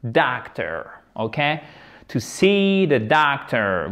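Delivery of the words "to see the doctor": slow, drawn-out EE in see; long vowel. Shown as a demonstration. Teacher demonstrating long vowels, see held long. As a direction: stretch see and the first vowel of doctor. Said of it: "Voyelle longue, I long".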